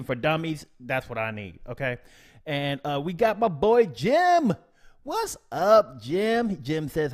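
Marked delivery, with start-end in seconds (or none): 3.0-6.6 excited greeting. Real, not acted. Greeting a regular viewer.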